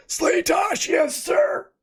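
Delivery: high shouted mocking impression answering the judge; mocking Lemoyne voice. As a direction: squeal it like an overeager lackey. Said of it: "I will be doing Lemoyne's voice".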